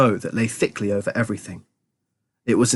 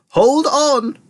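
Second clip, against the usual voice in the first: high, excited warning cry; urgent high-pitched call; cried out; 13 semitones higher, 3 dB louder than usual. A narrator's reading. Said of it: "cried Mister Wonka".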